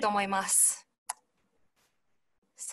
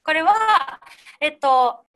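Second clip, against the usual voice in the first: ordinary talk, nothing marked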